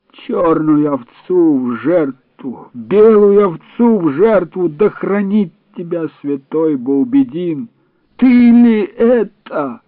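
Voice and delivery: high, slow, loud keening; wailing lament. A narrator's reading. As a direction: wail the prayers over him. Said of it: "запричитали старики".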